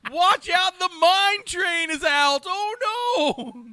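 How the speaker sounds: high, loud, slow prospector warning; old-timey prospector. Retold or imitated. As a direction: yell a warning like an old miner. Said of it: "a old-timey prospector in his old age".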